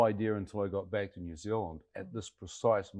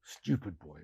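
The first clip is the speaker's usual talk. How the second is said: soft, drawn-back disapproving murmur; barely voiced, as if aghast at the boy; hushed Chinese man; 9 dB quieter, slower than usual. Man scolding an injured stranger, hushed imitation. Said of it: "The young, um, Chinese, uh, man looked at me".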